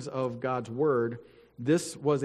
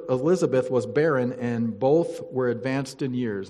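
louder, formal Bible reading voice; scripture reading; 5 dB louder than usual. Acted. Pastor reading Luke to the church, formal and solemn. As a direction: read it out to the congregation with weight. Reads scripture aloud to congregation.

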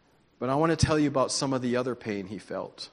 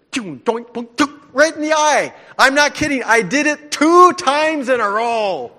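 much higher and louder, laughing disbelief; excited disbelief; 9 semitones higher, 10 dB louder than usual. Retold or imitated. Preacher telling the congregation about his BB gun mishap, excited disbelief. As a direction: tell it like you still can't believe it. Retelling shooting himself twice.

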